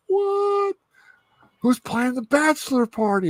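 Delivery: very high-pitched, giddy disbelief; thrilled surprise. Acted. Deadpool finding out Scott's getting married, thrilled surprise. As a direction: squeal it as big news lands.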